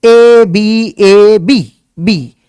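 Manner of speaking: letters spaced out one by one; slow spelling out. Shown as a demonstration. Teacher to class, spelling the string out slowly. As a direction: lay out each symbol slowly.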